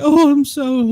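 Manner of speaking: high, whiny imitation of fake sobbing; mock fake crying. Podcaster mocking Vince crying fake tears to everyone, high mock sob. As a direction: mimic a houseguest crying crocodile tears. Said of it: "crying fake tears".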